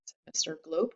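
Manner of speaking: ordinary delivery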